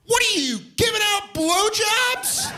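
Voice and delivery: put-on high, slow kid yelling; childlike shouted question; kid's scream. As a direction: squeal it out like a clueless kid. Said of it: "just screamed out".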